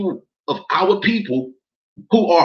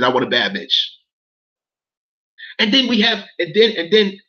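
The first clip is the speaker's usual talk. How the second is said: normal talking voice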